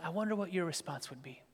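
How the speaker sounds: usual speaking voice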